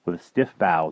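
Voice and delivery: plain reading voice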